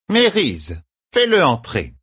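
high, commanding call; called-out order. A narrator's reading. Woman in charge orders the visitor let in, high commanding call. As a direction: throw the order across the room, high. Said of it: "lança Katsune".

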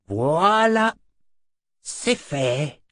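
tossing off the spell as nothing; sorcerer Zed, pleased. Acted.

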